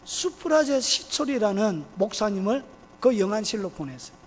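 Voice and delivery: ordinary delivery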